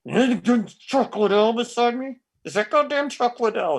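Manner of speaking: high, breathless disbelief; can't believe who's standing there; starstruck excitement. Retold or imitated. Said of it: "And I'm like".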